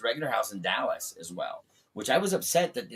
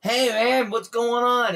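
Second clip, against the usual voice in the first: loud, high phone greeting; 12 semitones higher, 9 dB louder, slower than usual. Retold or imitated. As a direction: holler a hearty hello down the line. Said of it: "[name] looked at me".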